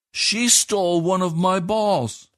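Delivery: put-on high, slow child's accusation; high, slow indignant boy at the neighbours; indignant boy accusing. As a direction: stress SHE, a boy tattling. Edward accuses Katie to Browns.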